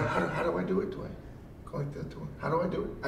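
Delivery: hushed, timid question; mock-nervous, nearly whispering the repeated questions; imitating a nervous child. Asking a wrestling partner how to do it, mock-nervous. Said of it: "like a nervous child".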